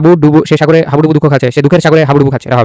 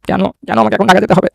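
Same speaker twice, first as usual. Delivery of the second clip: reluctant protest; 5 semitones higher, 3 dB quieter than usual. Acted. Acted dismay at Dhaka trip.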